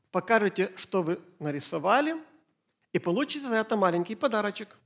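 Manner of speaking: usual speaking voice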